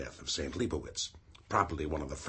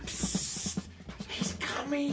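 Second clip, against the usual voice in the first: hissed whisper; much slower than usual. Acted. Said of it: "A low hiss from the stairway".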